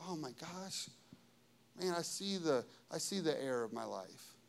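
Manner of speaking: hushed, low confession; humbled realization. Acted. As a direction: let it out softly, dawning on you.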